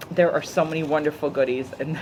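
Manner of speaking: ordinary talk, nothing marked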